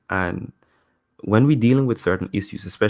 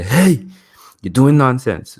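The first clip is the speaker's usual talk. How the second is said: raised, higher coach's yell; imitating a coach's shout; 4 semitones higher, 6 dB louder, slower than usual. Basketball coach to a player doing wrong, mock shout. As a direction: call out sharply like a coach correcting a player. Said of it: "coach is gonna shout at me".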